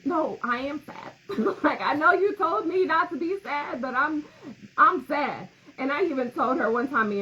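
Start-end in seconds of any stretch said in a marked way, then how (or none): 0.0-5.5 raised mock protest. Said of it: "but then I'd be like".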